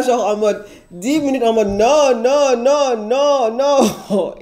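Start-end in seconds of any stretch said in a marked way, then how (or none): 1.7-4.3 high repeated no's; stunned denial. Retold or imitated. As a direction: shake off the news, high. Refusing to believe pregnancy. (refus stupéfait, voix plus aiguë)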